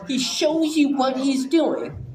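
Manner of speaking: normal talking voice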